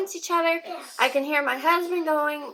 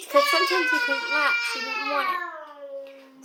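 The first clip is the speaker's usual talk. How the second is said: tearful; 4 semitones higher than usual. Real, not acted. Upset recalling breastfeeding struggle.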